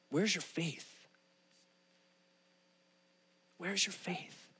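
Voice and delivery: very soft, measured question; almost hushed, leaving long silence after; quiet reproach. Retold or imitated. Jesus to his disciples after stilling the storm, quiet reproach.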